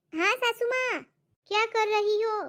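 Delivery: high, dutiful daughter-in-law; sweet daughter-in-law. Acted. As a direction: sweetly answer the mother-in-law. Daughter-in-law answers mother-in-law.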